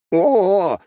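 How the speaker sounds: high surprised yelp as lifted off; startled yelp. Acted. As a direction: yelp in sudden fright. Taken up into air.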